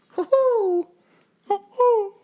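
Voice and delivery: high hooting owl call. Acted. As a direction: hoot like an owl. Owl sound after terrifying owls.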